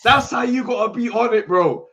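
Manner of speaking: loud hyped-up rallying; hype shouting. Real, not acted. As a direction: fire him up, shouting. Hyping up, shouting advice.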